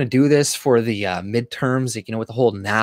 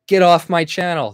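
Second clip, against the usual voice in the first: higher, louder joke of a command; mock angry order; 6 semitones higher, 4 dB louder than usual. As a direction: bark it as a pretend kick-out, hammed up. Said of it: "No, I'm kidding".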